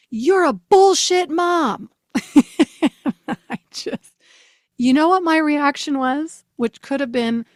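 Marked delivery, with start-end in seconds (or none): 0.1-1.9 higher, louder, slow mock yell; retold scream. Daughter yelling at mom, slow louder mock scream. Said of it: "she screams". 2.0-4.1 laughing as she speaks; can't hold the laugh back. Real, not acted. Retelling daughter's insult, breaking into laughter.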